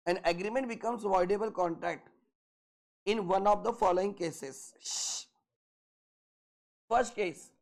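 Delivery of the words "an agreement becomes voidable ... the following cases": very slow, word by word dictation; dictating notes. Shown as a demonstration. Teacher to class, dictating notes very slowly. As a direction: spell it out slowly so they can write.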